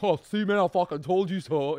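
loud, high gloating; crowing vindication. Retold or imitated. Friends whose dumb plan worked, crowing vindication. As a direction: rub it in at full volume.

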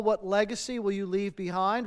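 ordinary talk, nothing marked

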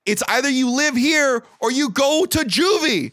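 high-pitched, exasperated scolding; exasperated dad. Retold or imitated. Dad threatening his son with juvie, exasperated.

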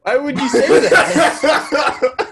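high, slow, cracking up; can't believe it, laughing; laughing disbelief. Real, not acted. Reacting to shocking remark.

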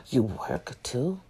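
low, quiet and slow, astonished; drawn-out disbelieving question; amazed repairman. Retold or imitated. Repairman amazed she also has a job, low and slow.

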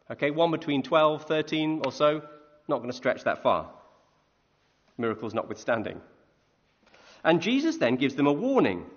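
normal talking voice

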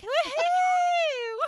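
high, loud whooping holler; imitating mom's catcall. Mom honking at a passerby to embarrass her kid, mock catcall. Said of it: "she would honk and be like".

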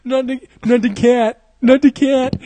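high, loud fake crying; hammed-up grieving wail; mock tearful wailing. Imitating everyone in tears over the cat, mock wailing. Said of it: "everyone was in tears".

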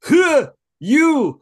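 put-on high, loud cranky old-timer shout; t-shirt slogan in the old man yell. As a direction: bark it like a geezer on the porch. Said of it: "this could be a t-shirt".

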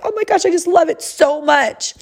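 high-pitched, hammed-up gushing; acted gushing delight. Mother pretending to love her Christmas sweater, acted gushing delight.

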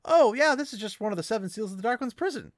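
high, fast and offhand; a quick, lightly voiced shrug of a reply; breezy casual brush-off. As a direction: toss it off like it's nothing. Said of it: "And he's like".

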